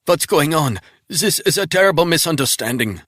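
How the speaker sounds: high, loud, desperate begging; panicked pleading. Acted. Wounded man pleading with Resnick not to kill him. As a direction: beg for your life, frantic. Captive protests.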